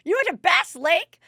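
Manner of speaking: high, loud mock-astonished question; mock incredulous question. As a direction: imitate a gobsmacked commenter, voice shooting up. Same mocking commenter voice.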